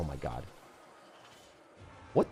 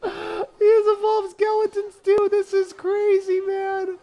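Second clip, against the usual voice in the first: much higher, slow pained exclaim; strained disbelief; 3 dB louder, slower than usual. Real, not acted. Gamer crying out at the opponent's evolved skeletons, strained disbelief. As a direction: squeak out your disbelief. Opponent's deck overwhelms him.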